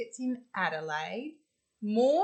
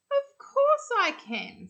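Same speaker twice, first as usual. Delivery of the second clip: high grandma voice; 14 semitones higher, 5 dB louder than usual. Acted. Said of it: "said Grandma Poss".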